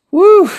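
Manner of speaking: loud, high "woo"; excited whoop. Real, not acted. Host reacts to rock feuds blowing up, loud high whoop. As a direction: let out one sharp high whoop.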